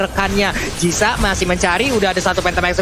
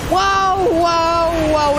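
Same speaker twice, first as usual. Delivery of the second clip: excited wow; 4 semitones higher, much slower than usual. Real, not acted. Reacting to savage.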